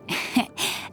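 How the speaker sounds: quiet breathy little laugh; soft chuckle. Acted. Teasing a friend over spicy food, soft chuckle. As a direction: chuckle softly under the breath.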